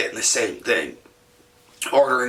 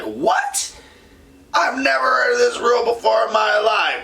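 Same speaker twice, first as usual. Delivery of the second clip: outraged disbelief; 9 semitones higher than usual. Retold or imitated. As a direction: protest like it's the craziest rule ever. Refused exchange, outraged disbelief.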